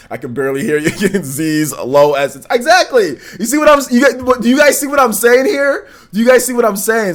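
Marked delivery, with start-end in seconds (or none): 2.5-7.1 high, loud, animated broadcasting voice; up, elevated voice. Real, not acted. Streamer vindicated after viewer can barely hear him, high and loud. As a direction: jump back up with full energy. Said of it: "you've gotta be up a little bit".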